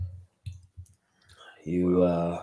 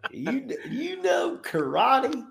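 laughing. Acted. Laughing shouted high quote.

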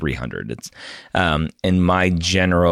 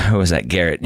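ordinary talk, nothing marked

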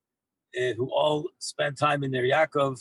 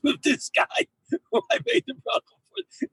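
laughing. Real, not acted. Chuckling aside about the neighbors.

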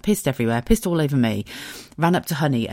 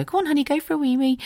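put-on baby talk to dog; 12 semitones higher than usual. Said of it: "you go".

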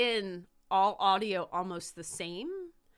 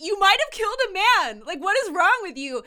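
loud, high-pitched outburst of reproach; outraged scolding; 12 semitones higher, 11 dB louder than usual. Retold or imitated. Friend confronting the angry mean guy, loud outraged yelling. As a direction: yell it as an appalled accusation.

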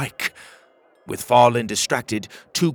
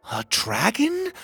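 much higher, slow astonished question; surprised; 12 semitones higher, slower than usual. Acted. Pocket seeing the dragon hatch, astonished. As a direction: gasp it out in wonder. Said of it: "Pocket said in surprise".

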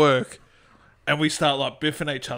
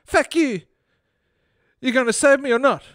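angry little man; 8 semitones higher than usual. Acted. Little man demands rescue from Fred, high and angry. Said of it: "said the little fat man".

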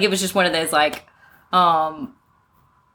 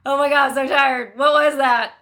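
put-on higher voice of a worn-out child; mock-dazed relief; dazed, exhausted kid; 5 semitones higher than usual. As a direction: act out a kid just up from naptime. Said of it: "they had this look of".

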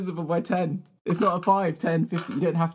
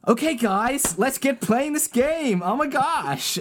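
put-on American accent; 8 semitones higher, slower than usual. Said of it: "Not subject to the rules and still doing it".

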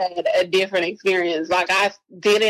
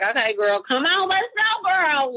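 cheery hurry-up; 7 semitones higher than usual. Retold or imitated. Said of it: "I'm, I'm like".

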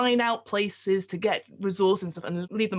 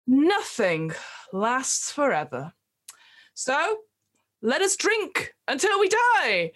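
barkeep's farewell speech; 3 semitones higher than usual. Acted. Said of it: "And he's like".

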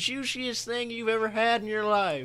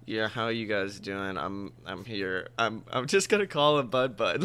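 much lower, quieter mumble; low mopey kid voice; imitating Bud Bud; 9 semitones lower, 5 dB quieter than usual. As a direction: drone it like a deadpan teen.

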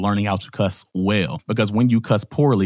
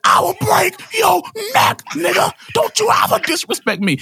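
mock fury. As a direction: squeal it out as a hammed-up angry dad. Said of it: "Kirk got so mad".

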